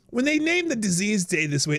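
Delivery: usual speaking voice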